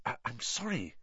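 hushed, stammered apology; whispered. A narrator's reading. Harry apologising to the wailing elf, whispered. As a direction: keep it very soft, almost under the breath. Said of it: "he whispered".

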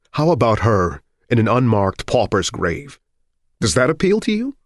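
heated husband arguing back; bitter challenge. A narrator's reading. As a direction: throw it at her as a bitter challenge. Husband's acted quarrel with his wife.

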